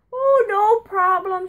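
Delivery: put-on high, slow old-lady voice; grandma's voice. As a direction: put on a sweet granny voice. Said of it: "she'd say".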